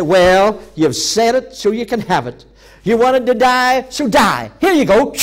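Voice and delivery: high, loud, mocking divine verdict; mock stern God. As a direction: hand down the sarcastic sentence loudly. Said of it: "when God didn't turn around and say".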